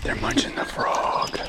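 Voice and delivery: hushed whisper. Real, not acted. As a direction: whisper so you don't spook the fish. Quiet moment watching a fish strike.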